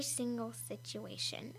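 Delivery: unvoiced stage whisper. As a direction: breathe it as an aside. Whispered agreeing aside.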